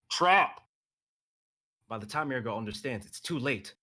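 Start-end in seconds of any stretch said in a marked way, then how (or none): 0.1-0.6 loud, high urgent yell; warning the team, shouted. Acted.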